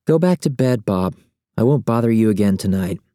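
lightly voiced young woman; female character voice. Ramona voiced by narrator.

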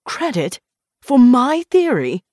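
affronted outburst, rising on the key words; indignant blurt. A narrator's reading. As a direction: blurt it out in disbelief. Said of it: "Weigert blurted".

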